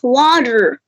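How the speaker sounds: "water" with a strong R at the end; American water. Shown as a demonstration. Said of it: "in American".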